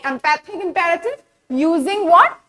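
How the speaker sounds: usual speaking voice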